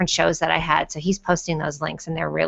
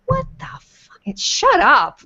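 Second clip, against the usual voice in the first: mock inner voice; 8 semitones higher, much slower than usual. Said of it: "and I'm like".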